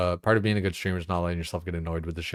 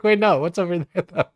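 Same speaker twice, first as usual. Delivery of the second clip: excited confusion; 11 semitones higher, 7 dB louder than usual. Retold or imitated. Retold confused reaction.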